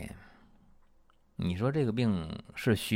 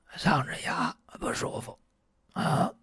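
hoarse whisper; 5 semitones higher, 3 dB quieter than usual. Retold or imitated. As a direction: whisper 嗓子哑 like a voice that is gone. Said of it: "patient shows the hoarseness". (模仿病人沙哑的气声 嗓子哑)

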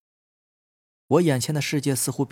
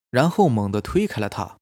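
usual speaking voice

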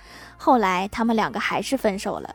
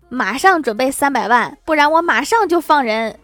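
mock furious kidnapper, louder and higher; a hammed-up fit of rage, louder; exasperated; 3 semitones higher, 7 dB louder than usual. Kidnapper furiously threatening the husband on the phone, loud mock rage. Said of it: "气急败坏地说".

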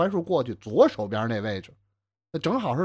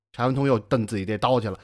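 ordinary delivery, nothing marked

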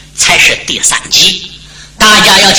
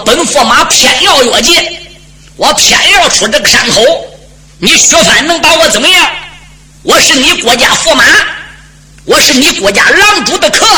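proud, higher, pressing defiance; defiant; 7 semitones higher than usual. Acted. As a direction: dare him to stop you. 驸马执意越界. (傲慢挑衅)

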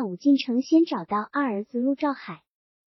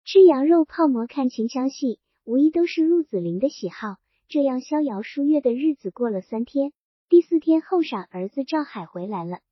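plain reading voice

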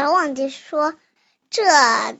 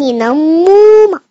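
loud, higher mooing question; cow's question; 4 semitones higher, 10 dB louder than usual. Acted. Child as the cow asking the ant, loud moo. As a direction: stretch the moo out loudly.

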